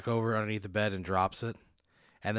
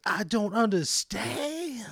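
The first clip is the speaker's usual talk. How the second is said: high, slow, squeaky and baffled; slow squeaky confusion; doctor's voice; 12 semitones higher, 4 dB louder, much slower than usual. Acted. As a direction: drag it out in a high, puzzled voice. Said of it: "He goes".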